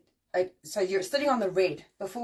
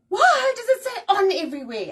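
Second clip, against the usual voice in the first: much higher, louder baffled complaint; exasperated outburst; 10 semitones higher, 8 dB louder than usual. Retold or imitated. Texter yelling at autocorrect in exasperation. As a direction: yell at the phone in disbelief. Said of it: "you're like".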